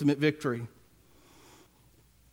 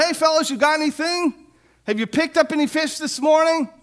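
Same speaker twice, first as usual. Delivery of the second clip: calling out; 8 semitones higher, 3 dB louder than usual. Retold or imitated. Jesus calls out to fishermen.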